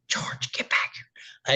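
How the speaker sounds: slow hushed whisper to the dog; whispered call. Retold or imitated. Calling the neighbor's loose dog back in a slow whisper. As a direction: hiss the dog's name softly, slowly.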